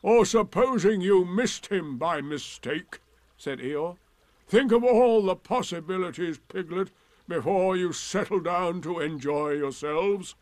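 big scornful donkey voice; sarcastic Eeyore. Acted. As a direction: sound heavily put-upon and ironic.

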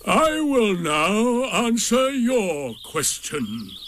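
very slow, high solemn pronouncement. A narrator's reading. Eeyore about to answer Rabbit's question, solemn. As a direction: stretch every word with solemn importance. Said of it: "he said solemnly".

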